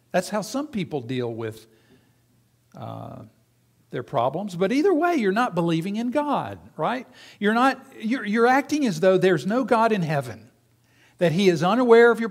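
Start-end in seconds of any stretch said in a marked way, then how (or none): none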